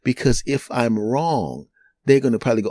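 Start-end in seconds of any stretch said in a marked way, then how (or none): none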